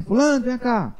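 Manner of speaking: high loud call across the court. Retold or imitated. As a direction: shout his name over the yard. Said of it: "falei alto que eu tava na quadra". (chamando alto na quadra)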